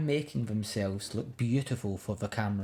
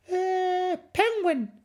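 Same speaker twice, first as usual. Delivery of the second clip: high, hesitant little fib; fibbing cub; 3 dB louder, slower than usual. Acted. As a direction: stall then blurt the lie. Cub fibs nervously.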